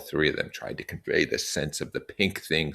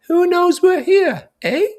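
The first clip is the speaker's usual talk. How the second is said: put-on very high, slow, fussy boy voice; Piggy scolding; 8 dB louder, slower than usual. Piggy telling the boys off for hindering Ralph, high and fussy. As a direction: nag them about what matters.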